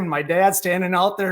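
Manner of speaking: ordinary talk, nothing marked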